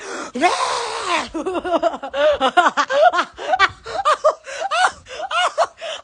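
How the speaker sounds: long, high laughing fit; high, gasping run of laughter; helpless laughter. Real, not acted. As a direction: laugh helplessly, climbing in pitch. Laughing fit after closing the door.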